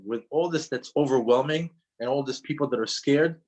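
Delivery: ordinary talk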